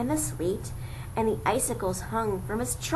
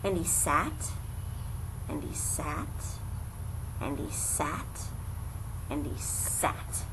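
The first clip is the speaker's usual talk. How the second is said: each and he sat lower and softer, to a whisper; fading repetition; 6 semitones lower, 8 dB quieter than usual. A narrator's reading. Narrator showing endless sitting, fading to a whisper. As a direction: let it die away line by line.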